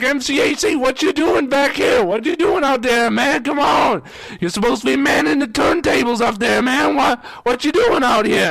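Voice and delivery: high-pitched, incredulous questioning; agitated, imitated nagging; exasperated scolding. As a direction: act out a coworker demanding an explanation. Coworker scolds him at back door.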